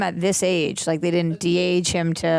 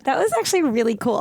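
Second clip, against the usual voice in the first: higher, laughing mock gush; teasing imitation; 7 semitones higher than usual. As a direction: parrot her line back, teasing her with a laugh. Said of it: "You said".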